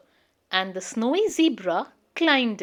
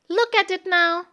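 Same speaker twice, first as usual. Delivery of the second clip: a mild excited cry, a bit higher; little bear's gentle eager cry; cried out; 7 semitones higher, 3 dB louder than usual. Acted. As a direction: call out with light delight. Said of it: "he cried".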